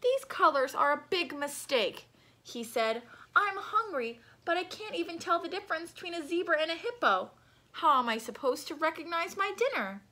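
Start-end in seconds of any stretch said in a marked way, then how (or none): 0.0-2.1 complaining. Acted. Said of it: "Lion started complaining". 3.4-10.0 high, grumbling complaint; lion's whiny grumble. Acted. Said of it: "Lion started complaining".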